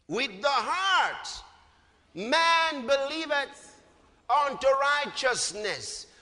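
slow, drawn-out recital; slow verse reading. Shown as a demonstration. Preacher leading the congregation, slow recital. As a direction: read each word for them to repeat. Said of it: "Let's read that verse together".

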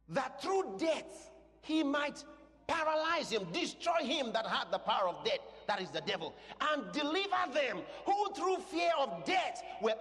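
high, emphatic scripture proclamation; full-force preaching. Real, not acted. Preacher declaring the devil's defeat from scripture, full-force preaching. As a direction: drive each phrase home to the congregation.

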